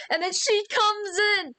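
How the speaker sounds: high-pitched scared squeal; mock scared cry. Imagining a scare.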